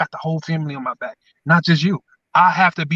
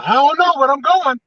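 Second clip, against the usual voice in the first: laughing mock answer; 8 semitones higher, 3 dB louder than usual. Plays his clueless past self.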